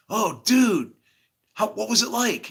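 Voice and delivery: high, loud, eager curiosity; excited friend. Acted. Friends asking him as he wakes, excited. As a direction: play the amazed buddy.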